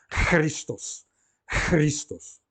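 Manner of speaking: "Christos" opening on a long breathy rasped "ch"; aspirated chi. Shown as a demonstration. Teacher showing the aspirated ki in Christos, long voiceless friction. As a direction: draw out the airy guttural at the start of "Christos". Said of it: "correspondente aspirada".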